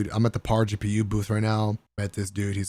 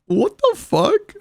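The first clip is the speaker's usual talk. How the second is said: stunned disbelief; 4 dB louder, much slower than usual. Retold or imitated. Retold reaction to bathroom items.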